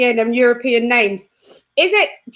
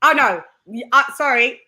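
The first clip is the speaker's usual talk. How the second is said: usual speaking voice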